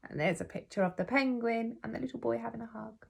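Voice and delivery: ordinary delivery, nothing marked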